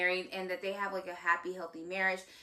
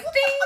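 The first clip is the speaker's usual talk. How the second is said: high, loud "ding!"; playful cartoon-style ding; imitated ding; 12 dB louder than usual. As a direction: ring out a bright sparkle sound effect. Imitates the billboard's gleaming ding.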